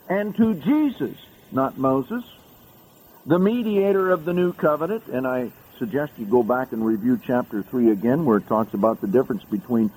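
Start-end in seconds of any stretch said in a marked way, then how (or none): 0.1-5.0 soaring scripture proclamation. Acted. Preacher proclaiming Mount Zion to the congregation, soaring and high. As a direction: lift every phrase up toward heaven.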